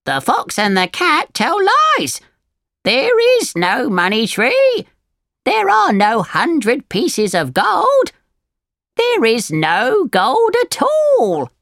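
high, swooping bird voice; storybook parrot telling someone off; scolding parrot. A narrator's reading. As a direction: drive it home as a high-pitched parrot.

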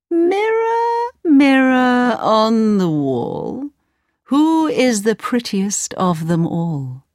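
high sing-song queen calling her mirror; the queen, lilting and slow. Acted.

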